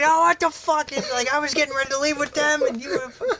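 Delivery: high-pitched imitated indignation; acted-out outraged rant. Nancy ranting after the wrong car, high mock outrage. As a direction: rattle off a hammed-up complaint.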